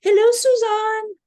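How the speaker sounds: high, loud Swiss French hello; Swiss French accent. Retold or imitated. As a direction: call out a delighted greeting in a Swiss French accent. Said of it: "with his Swiss French accent".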